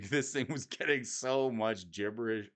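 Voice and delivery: usual speaking voice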